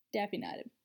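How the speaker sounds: ordinary delivery, nothing marked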